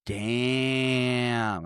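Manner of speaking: one long, sustained "Damn"; imitated slow, lingering reaction; drawn-out damn. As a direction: stretch the vowel out in mock awe. Retold awestruck reaction, word held long.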